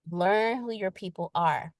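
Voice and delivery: normal talking voice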